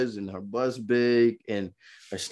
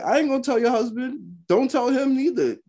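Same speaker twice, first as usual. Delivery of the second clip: higher, mock-defensive reply; high retorting voice; 13 semitones higher than usual. A young Marine answering the sergeant's wife, in a raised mock voice. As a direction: toss it back at her, pitched up. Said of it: "I was like".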